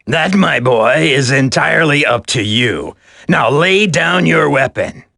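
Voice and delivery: normal talking voice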